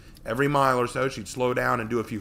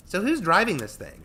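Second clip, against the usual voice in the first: put-on high, loud girl question; bossy harpy girl; 10 semitones higher, 9 dB louder than usual.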